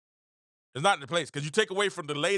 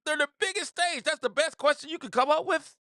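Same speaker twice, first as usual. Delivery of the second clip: way up high, incredulous; high mock exasperation; 9 semitones higher than usual. Telling reporters off in a high mocking voice. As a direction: squeak out the complaint. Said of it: "But I'm just like".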